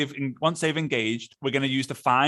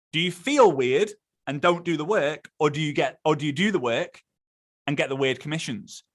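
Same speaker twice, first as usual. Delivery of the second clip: normal talking voice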